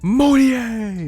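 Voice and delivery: excited shout. Retold or imitated. Recalling a famous dunk call.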